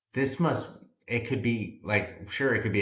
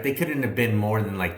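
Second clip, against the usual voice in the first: ordinary talk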